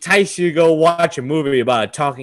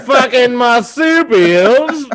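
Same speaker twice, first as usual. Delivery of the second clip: loud, higher hammed-up Aussie; mock Australian rant; 7 semitones higher, 5 dB louder than usual. Host ranting about koalas in loud mock Aussie accent. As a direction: rant like an angry bushman. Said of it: "That accent change".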